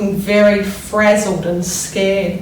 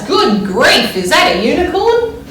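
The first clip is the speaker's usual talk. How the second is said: a truck driver who can't believe his eyes; surprised shriek; 6 semitones higher, 3 dB louder than usual. A narrator's reading. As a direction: voice jumps high on the question in amazement. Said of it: "he shrieked in great surprise".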